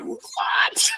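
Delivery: a breathless, voiceless "What?" in disbelief; gasped what. Retold or imitated. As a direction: push out the word on air alone. Stranger's confession stuns him.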